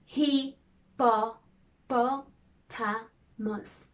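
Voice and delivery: hippopotamus stretched very slowly, syllable by syllable; hand-tip syllables. Shown as a demonstration. Teacher tapping syllables for the class, very slowly. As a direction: drag out every part of hippopotamus. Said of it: "Now let's do the hand tip".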